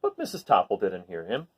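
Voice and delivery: plain reading voice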